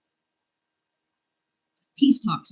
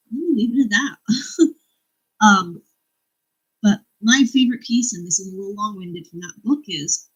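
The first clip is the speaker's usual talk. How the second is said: ordinary delivery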